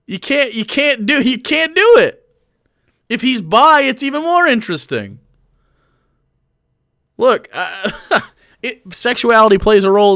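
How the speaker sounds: squeaky incredulous protest; excited high protest. Real, not acted. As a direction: push the point, voice way up.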